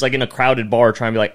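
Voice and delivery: ordinary talk, nothing marked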